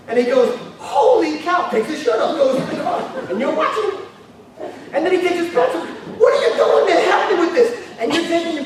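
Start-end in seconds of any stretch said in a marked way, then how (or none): 0.8-1.7 high, amazed exclamation. Acted. Friend finding the gold coins, amazed. 6.2-7.7 fast, high, loud demand; frantic. Acted. Man loading coins demanding help, frantic.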